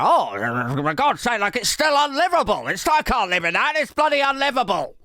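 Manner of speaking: high, put-upon homeowner whine; exasperated complaint. Acted. Acted complaint of Keir returning home.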